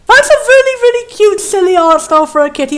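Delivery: high, loud delighted squeal of words; excited gushing. Real, not acted. Player delights in the kitty's art style, gushing loudly. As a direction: gush at full pitch over something cute.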